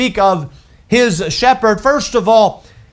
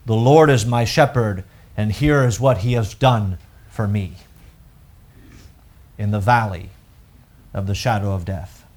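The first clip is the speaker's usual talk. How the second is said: low, hushed reading of the psalm; scripture reading; 11 semitones lower, 5 dB quieter than usual. Acted. Preacher reading Psalm 23 to the congregation, hushed. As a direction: read the verse softly and reverently.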